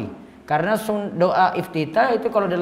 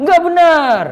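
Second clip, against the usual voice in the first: much higher, louder crowd answer; mock congregation reply; 10 semitones higher, 8 dB louder, slower than usual. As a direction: shout back like the crowd. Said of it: "Nggak bisa kalau khutbah Jumat". (meniru jawaban jamaah dengan suara tinggi)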